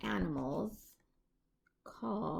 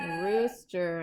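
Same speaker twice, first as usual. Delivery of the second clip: ordinary delivery, nothing marked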